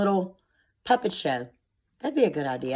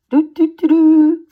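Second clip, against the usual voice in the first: loud high tooting horn noise; 9 semitones higher, 9 dB louder than usual. Shown as a demonstration. Teacher showing children a paper tube horn, loud tooting. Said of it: "We were making horns".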